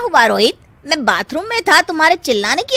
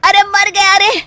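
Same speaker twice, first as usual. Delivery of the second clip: pained cries; 8 semitones higher than usual. Acted. Beaten again in the dark.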